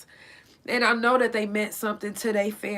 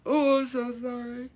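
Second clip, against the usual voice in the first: tearful apology; 3 semitones higher than usual. Real, not acted. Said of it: "make me so emotional".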